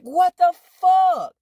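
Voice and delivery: very high, loud, drawn-out shriek; outraged yell. Retold or imitated. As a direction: stretch out a disbelieving high yell. Retold outraged reaction to lie.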